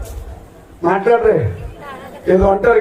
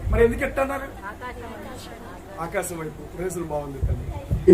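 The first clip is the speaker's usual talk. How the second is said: quiet; 2 semitones lower, 12 dB quieter than usual. Real, not acted. Drops voice softly.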